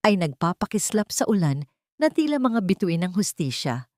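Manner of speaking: ordinary delivery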